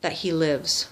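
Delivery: ordinary delivery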